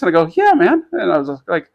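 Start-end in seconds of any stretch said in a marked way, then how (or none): 0.3-0.9 much higher, louder mock enthusiasm; retold upbeat reply. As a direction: answer like a delighted newcomer. Acts out reply to friendly greeting.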